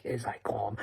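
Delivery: a mocking stage whisper. Real, not acted. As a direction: breathe it out like a secret aside. Whispered jab at DJ.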